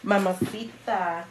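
mock a creepy flirt, hushed; quietly crooned catcall, imitated; flirting man. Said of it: "trying to fucking flirt with me".

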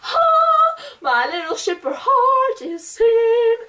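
high, loud squeal, slowing into a sung line; high sung swoon. Retold or imitated. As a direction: squeal with shipper joy. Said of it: "I was like".

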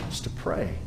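breathy whisper, nearly silent. Real, not acted. Preacher whispering to his church that the answer is prayer. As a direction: lean in and whisper it to the congregation. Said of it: "whispered point in sermon".

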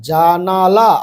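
জানালা drawn out syllable by syllable; slow pronunciation. Shown as a demonstration. Teacher showing pupils how to read a long word, slowly. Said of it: "আস্তে আস্তে আগে উচ্চারণ করবে".

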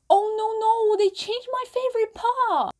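high, slow cry of protest; mock dismay. Real, not acted. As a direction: wail about the change. Dismay at changed part.

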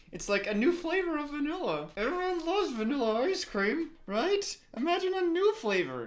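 high-pitched, strained sales pitch; mock enthusiasm. Viewer talking up a boring scene, high mock enthusiasm. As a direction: push the voice up high, feigning wonder.

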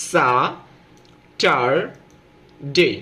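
"সা, টার, ডে" with long pauses between; each part of "সা, টার, ডে" said alone; syllable by syllable. Shown as a demonstration. Teacher showing the three parts of Saturday, syllable by syllable. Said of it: "এখানে তিনটা অংশ".

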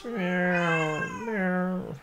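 high drawn-out imitated meow; cat meow. Imitating the cat begging to go out, high and long. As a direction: mew like a wanting cat. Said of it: "sometimes it's this".

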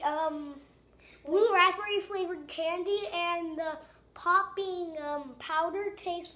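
talking with finger at mouth; finger on lips. Real, not acted. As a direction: lips partly covered by finger. Seen 0: no lollipop during the words; finger held at his lips while talking.

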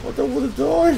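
high, loud half-laughing plea; mock panic. Real, not acted. Gamer running from the boss, high loud mock panic. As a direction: cry it out while fleeing.

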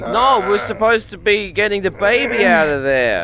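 loud, exasperated shouting at a partner; exasperated yelling. Acted. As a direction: yell at him for grabbing the knife. Exasperated quarrel over the knife.

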